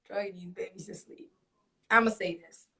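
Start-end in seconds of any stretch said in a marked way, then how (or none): 0.1-1.3 very soft repeat at normal pitch; under the breath. Real, not acted. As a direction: say it quietly, almost to yourself. Same words repeated under breath.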